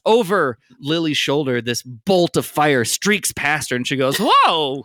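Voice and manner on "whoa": startled whoa. Acted. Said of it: "That's what she sounds like".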